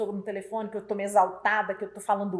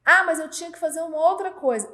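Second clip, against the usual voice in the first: higher, louder mock excuse; imitated protest; 6 semitones higher, 6 dB louder than usual. Employee excusing himself to the boss, higher, louder protest. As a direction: protest like an employee caught out, raised voice. Funcionário protesta com desculpa.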